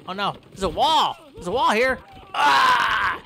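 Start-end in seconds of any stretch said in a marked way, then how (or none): 2.3-3.2 sudden loud yelp of alarm; startled shout. Real, not acted. Gamer yelping as the gator drags him under. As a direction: cry out as something grabs you.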